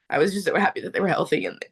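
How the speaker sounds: real tears welling, pitch climbing; choked up. Real, not acted. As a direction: get choked up with relief as you recall it. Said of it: "I knew this was gonna happen".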